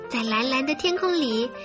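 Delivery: ordinary delivery, nothing marked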